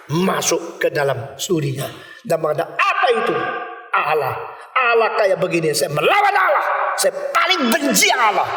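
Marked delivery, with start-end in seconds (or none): 2.7-8.5 put-on angry young man. Preacher voices angry young man bursting into pastor's study, high shouting. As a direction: rant like a furious young atheist. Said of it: "Seorang pemuda yang marah sekali".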